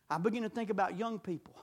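usual speaking voice

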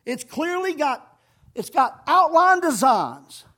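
loud, scolding pulpit delivery; hard-hitting preacher in full flow; forceful preaching. Real, not acted.

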